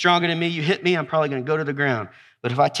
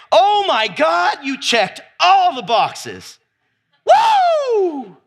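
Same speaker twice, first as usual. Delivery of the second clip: high, drawn-out gush of mock delight; mock amazement; 11 semitones higher, 5 dB louder, much slower than usual. Preacher imagining an impressed Jesus, in mock amazement. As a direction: gush it loudly, ending in a high whoop. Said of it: "did you hear Jesus say".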